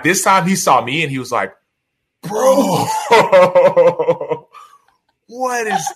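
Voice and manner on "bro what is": high, loud, laughing disbelief; a buddy cracking up at the sight; shocked teammate. Retold or imitated. Teammate laughing at his swollen face in disbelief.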